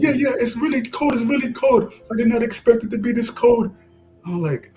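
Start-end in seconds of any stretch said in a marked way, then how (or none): 0.0-3.7 much higher imitated Senegalese accent through a laugh. Senegalese man complaining about Detroit cold, higher imitated accent with laughter. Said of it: "He had this really heavy, you know, accent".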